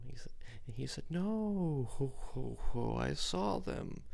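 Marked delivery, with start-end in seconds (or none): 1.1-4.0 jolly Santa, higher and brighter. Retold or imitated. Santa telling his friend he checks the lights, jolly. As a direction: ho ho ho in a slow belly laugh.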